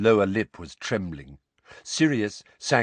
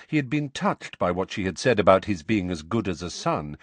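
ordinary delivery

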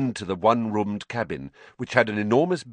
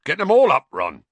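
hearty shout; 9 semitones higher, 9 dB louder than usual. Acted. Hagrid voiced, booming.

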